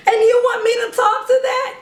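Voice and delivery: high, laughing mock disbelief; incredulous parent. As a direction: play the stunned parent who can't believe it. Pretend parent protests, laughing.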